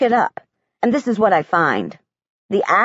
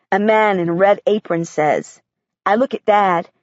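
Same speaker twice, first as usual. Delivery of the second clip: plain reading voice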